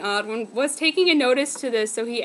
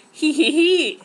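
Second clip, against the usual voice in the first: sinister giggle; 3 semitones higher, 6 dB louder, slower than usual. Acted. Villainous giggle before scheme.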